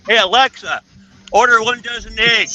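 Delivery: calling out to Alexa. Retold or imitated. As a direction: call the command across the room. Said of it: "the one where you go".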